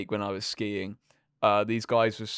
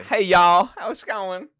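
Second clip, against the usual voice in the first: high, loud Texan greeting; mock Texan accent; 10 semitones higher, 13 dB louder, slower than usual. Imitating a Texan girl for friends, mock Texan accent. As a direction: put on a perky Texan y'all voice. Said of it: "like Texan drawl".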